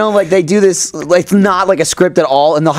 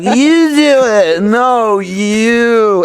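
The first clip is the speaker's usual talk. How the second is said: imitating a childish squabble; 6 semitones higher, much slower than usual. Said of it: "You know, like".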